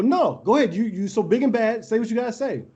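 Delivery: high-pitched goading, retold; mocking dare. Player goads the kid to repeat his insult, retold mocking dare.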